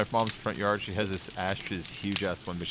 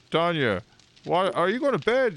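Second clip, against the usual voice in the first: imitating dad; 8 semitones higher, 4 dB louder than usual. Dad voiced in story.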